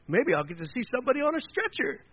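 high-pitched nosy thrill; mock eager gawker. Mocking a gawker hoping to see an accident, gleeful. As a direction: put on a rubbernecker's eager voice.

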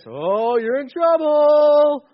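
loud, drawn-out singsong warning; mock scolding. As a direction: tease them with a long, high, swelling "you're in trouble".

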